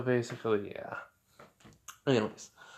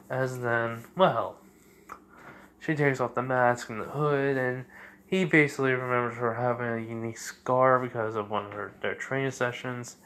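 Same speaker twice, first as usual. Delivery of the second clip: ordinary talk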